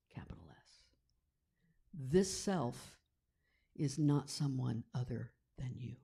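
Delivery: hushed reverent recitation of sacred text; scripture reading. Acted. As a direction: read the verses like a quiet incantation. Reading the Kena Upanishad aloud.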